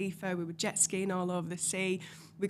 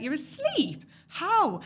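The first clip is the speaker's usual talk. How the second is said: high, loud disbelief at her sleeping; incredulous; 7 semitones higher, 5 dB louder, much slower than usual. Retold or imitated. Marvelling at her girlfriend dozing off, high and astonished. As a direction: squeak out how she can possibly sleep.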